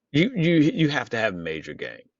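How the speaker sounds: stuttering in disbelief; real baffled stutter on the opening word. Real, not acted. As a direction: stumble over the first word, then get it out. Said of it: "that's why I'm stuttering".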